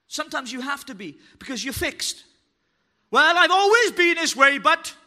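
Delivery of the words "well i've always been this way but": loud mocking excuse; mock whiny excuse. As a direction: parody the stubborn churchgoer.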